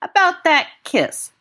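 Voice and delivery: slow, wry half-smile. A narrator's reading. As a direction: say it with a twisted smile. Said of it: "with a twisted sort of smile".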